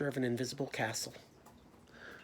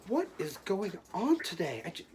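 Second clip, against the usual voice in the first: acted frustration; 5 semitones higher than usual. Acted frustration with stuck book.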